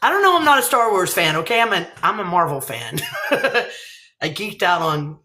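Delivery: normal talking voice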